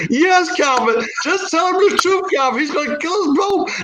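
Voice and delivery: high-pitched pleading wife voice; panicked wife. Acted. Wife begs husband to confess, panicked. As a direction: beg in a high put-on woman's voice.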